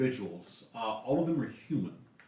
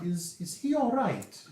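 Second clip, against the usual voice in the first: concerned lady; 12 semitones higher, 3 dB louder, slower than usual. Acted. GM voices Lady Drana concerned.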